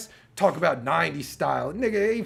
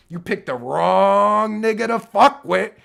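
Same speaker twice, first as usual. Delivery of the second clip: nineties lyric; 3 semitones higher, 7 dB louder, slower than usual. Pretend. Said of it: "that's a nineties lyric right there".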